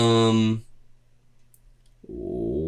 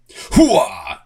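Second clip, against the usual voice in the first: loud gung-ho soldier; 8 dB louder than usual. Acted. Cheering at 'inverted triangle' body type.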